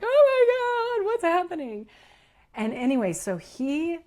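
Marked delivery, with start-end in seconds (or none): 0.0-1.8 very high, loud comic wail; mock panic. Human self reacts as energy sings through her, high mock-panicked wail. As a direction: squeal it in hammed-up alarm. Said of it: "my human was like".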